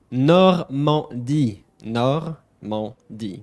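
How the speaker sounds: "Normandy" as "nor-mahn-DEE", drawn out; slow French demo. Shown as a demonstration. Teacher presenting the next name, slow French syllables of "Normandy". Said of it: "Next one over is going to be".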